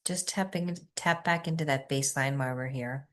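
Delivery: soft, quiet meditation guidance; guided meditation. Acted. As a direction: ease the group into stillness softly.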